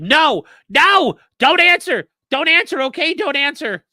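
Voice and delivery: high, loud imitation of panicked objecting; mock frantic objection. Retelling him stopping her from answering, high frantic mock shouting.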